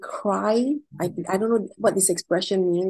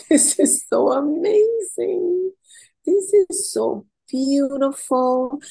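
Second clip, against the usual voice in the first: high, drawn-out gush of wonder; retold tearful awe; 6 semitones higher, 4 dB louder, slower than usual. Wedding guest retelling her tears under the chuppah, gushing in awe. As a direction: stretch each phrase out, re-enacting overwhelmed joy. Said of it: "I'm on the floor crying. I said".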